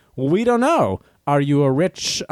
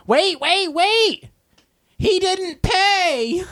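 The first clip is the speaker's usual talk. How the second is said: much higher frantic mock shout, slowing at the end; imitating a yell; 14 semitones higher, much slower than usual. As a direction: play the alarmed checkout lady. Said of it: "she's yelling at the person".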